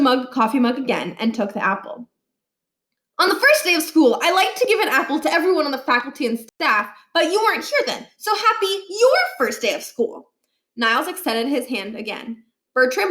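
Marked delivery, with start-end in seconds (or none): 3.2-10.3 put-on higher, fast, syrupy boyish voice; sweet-talking kid. A narrator's reading. Boy handing the principal an apple, overly sweet. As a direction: lay the charm on thick.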